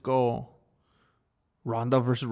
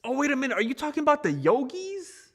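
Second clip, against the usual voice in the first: much higher surprised question; surprised realization; 11 semitones higher, faster than usual. Real, not acted. Streamer realizing which snack chat means, surprised and high. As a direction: suddenly catch on, high.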